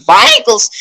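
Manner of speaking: high shouted Vikings; excited shout. Shown as a demonstration. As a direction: yell it like a battle cry. Shouted show title.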